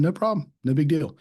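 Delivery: high, bright easygoing no problem; breezy, unbothered salesman reply; cheery brush-off. Retold or imitated.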